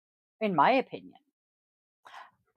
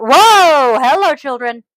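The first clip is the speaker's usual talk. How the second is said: loud, surprised hello; surprised greeting; 4 semitones higher, 11 dB louder, slower than usual. Real, not acted. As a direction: react to the dogs bursting in. Interrupted by dogs.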